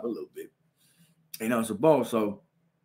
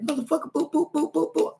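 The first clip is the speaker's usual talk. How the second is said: much higher boop noises; mock blows; 13 semitones higher than usual. Girl hitting the back of his head, high boop noises. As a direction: mimic the girl's hits.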